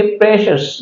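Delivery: प्रेशियस with the ci read as sh; English pronunciation. Shown as a demonstration. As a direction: say प्रेशियस as "PRESH-us". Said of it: "इसको पढ़ेंगे".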